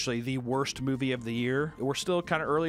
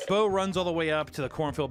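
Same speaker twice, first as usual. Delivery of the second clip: usual speaking voice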